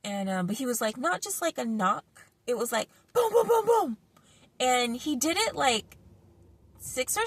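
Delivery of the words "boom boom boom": banging sounds. Retold or imitated. Imitating the loud banging.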